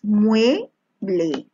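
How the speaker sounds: "mueble" in two separated syllables; teacher splitting "mueble" for the class. Shown as a demonstration. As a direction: say "mueble" slowly, pausing between its two syllables. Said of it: "separa en sílabas".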